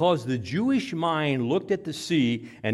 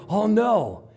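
acted alarm; 7 semitones higher, 6 dB louder than usual. Seeing the thing cross the water.

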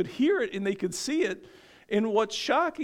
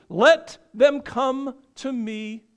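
slow, higher, louder command; commanding Jesus; 5 semitones higher, 7 dB louder, much slower than usual. Retold or imitated. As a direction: plead each word slowly and firmly as Jesus. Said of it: "I can imagine Jesus thinking".

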